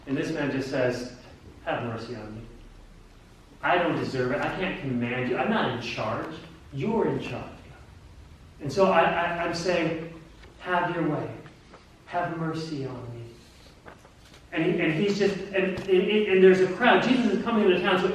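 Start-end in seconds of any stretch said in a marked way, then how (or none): 1.6-13.2 soft, low, humble pleading; humble surrender. Acted. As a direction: yield gently, voice dropping away. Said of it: "approaching Christ with humility".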